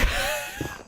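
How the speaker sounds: shrill burst of laughter; high squeal laugh. Real, not acted. Laughs at own joke.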